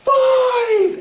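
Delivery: very high, loud shout of triumph; hammed-up jackpot shout; excited shout. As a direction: yell it like you just hit the jackpot. Ad character shouting win.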